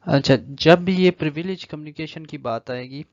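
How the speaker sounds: ordinary talk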